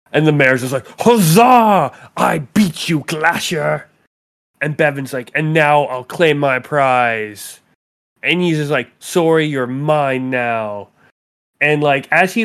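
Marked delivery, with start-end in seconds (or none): 1.0-3.9 gloating mayor. Acted. 5.3-7.6 slow grand declaration. Acted. GM voices Bevan's dramatic claim. 9.0-10.9 slow grand declaration. Acted. GM voices Bevan, dramatic.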